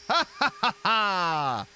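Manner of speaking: loud, slow evil cackle; villain laugh. Pretend. Cackling at the explosion.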